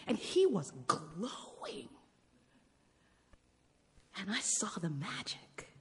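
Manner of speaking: slow, soft whisper; hushed whisper. Real, not acted. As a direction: breathe it out in awe. Moved recollection of son.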